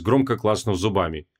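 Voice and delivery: ordinary delivery, nothing marked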